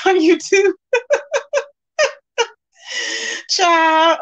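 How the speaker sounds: breaking into high laughter; laughing. Real, not acted. Reading a viewer's doxing joke to the stream, laughing. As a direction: crack up while talking.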